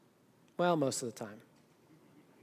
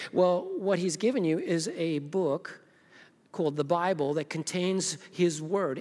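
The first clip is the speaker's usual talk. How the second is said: usual speaking voice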